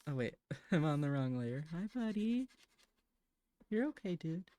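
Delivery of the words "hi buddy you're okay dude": high sing-song voice for the cat; soothing a restless cat, high cooing pet talk. Real, not acted. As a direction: coo at your pet in a much higher voice. Talking sweetly to her cat.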